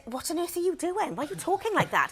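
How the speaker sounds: high-pitched mock disbelief; baffled questioning, pitched high; retold baffled reproach. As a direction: act out a puzzled telling-off. Ruth retold questioning odd accent.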